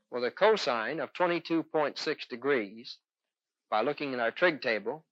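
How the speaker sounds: ordinary talk, nothing marked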